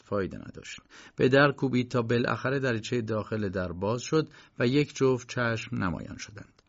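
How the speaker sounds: ordinary delivery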